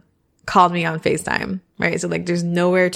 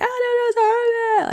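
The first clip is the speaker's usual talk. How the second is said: deflecting; much faster than usual. Retold or imitated. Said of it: "I just wanna push it away".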